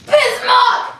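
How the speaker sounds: furious accusing yell; angry shout. Acted. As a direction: bellow the betrayal. Gorchakov bursts in accusing Bismarck.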